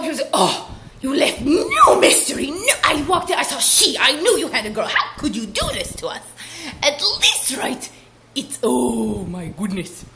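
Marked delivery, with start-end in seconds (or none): 0.3-8.6 louder, laughing complaint; hammed-up guest, louder and laughing; mock outraged guest. 8.6-10.0 slow, strange oh my goodness; odd drawn-out exclamation. Real, not acted. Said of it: "I don't know why I said it like that".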